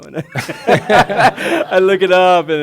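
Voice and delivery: slow, loud and high, laughing as he talks; can barely get it out for laughing; laughing through words. Real, not acted. Retelling his French fumbles, laughing loudly through the words.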